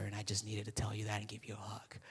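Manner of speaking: whispered tenderly; soft whisper. Retold or imitated. Friend telling the pastor his dad would be proud, whispered. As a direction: hush it like a secret blessing.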